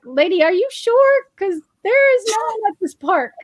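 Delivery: a hint of a puzzled Uber driver questioning her; doubtful driver. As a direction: driver double-checking a passenger, mildly doubtful.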